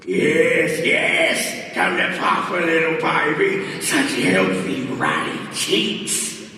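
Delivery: put-on loud, laughing Australian accent; hammy Australian accent, slowing down. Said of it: "the Australian is pretty good".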